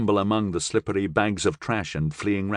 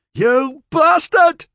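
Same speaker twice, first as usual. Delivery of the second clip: very high, loud furious scream; Billy Joe screams at the possessed man; 7 dB louder, much slower than usual. Acted. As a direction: scream it at the captor. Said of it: "Billy Joe screamed".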